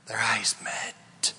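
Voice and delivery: hushed whisper, slow. Acted. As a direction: breathe the words out softly. Dramatic moment in the story.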